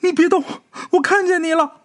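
very high, urgent shout; high excited shout toward the pool; girl shouting. A narrator's reading. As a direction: cry out as if spotting him. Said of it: "对着泳池里边喊".

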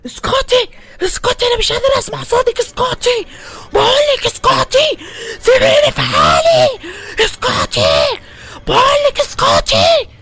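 high frantic screams, building to shouts; screaming madly. Acted. Shady in the asylum screaming at Hend to stop, frantic. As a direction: scream at the voice in your head. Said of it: "شادي كان قاعد عمال بيصوت جوه".

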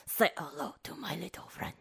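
whispered movie line. Acted. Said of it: "I don't think that sounded like Al Pacino".

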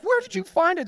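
high-pitched eager ask; amazed question. Retold or imitated. Gift receiver asking excitedly where it came from. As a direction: gush with wonder.

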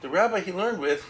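ordinary talk

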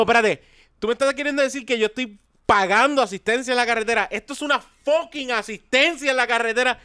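retold indignation. Customer protesting to roadside assistance agent, retold indignation. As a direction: argue back, outraged, pitch up.

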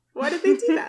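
puzzled mock outrage; disbelieving rewatch; baffled. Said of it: "I was like".

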